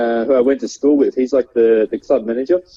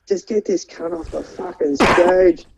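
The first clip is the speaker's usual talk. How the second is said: slow, higher-pitched mock heckle; imitated angry heckler; 4 semitones higher, slower than usual. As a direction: drag out the demand without really shouting. Imitated heckler demanding he leave.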